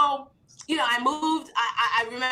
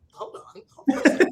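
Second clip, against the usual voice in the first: laughing; 3 semitones higher, 5 dB louder than usual. Retold or imitated. Retold reply, laughing through it.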